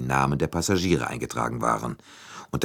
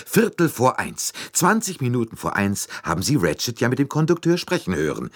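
high, louder burst of triumph; triumphant; 8 semitones higher, 3 dB louder than usual. A narrator's reading. Monsieur Bouc works out the crime's exact time, triumphant. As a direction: announce the time like a clinching proof. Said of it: "sagte er triumphierend".

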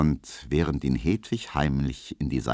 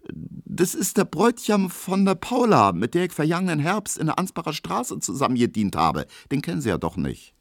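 maid's chatter; 10 semitones higher than usual. A narrator's reading. Maids name acquaintances.

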